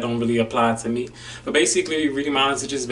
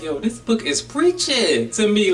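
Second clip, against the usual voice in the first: high, drawn-out exclamation; re-enacted excited outburst; excited realization; 9 semitones higher than usual. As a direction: exclaim it high and stretched out, amazed. Acting out excited reaction to book.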